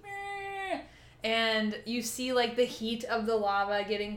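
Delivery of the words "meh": high, whiny long-neck bleat; dying dinosaur moan. Retold or imitated. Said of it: "He does the whole".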